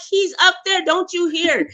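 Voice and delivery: lightly voiced woman urging him to listen. Voicing upset Rose.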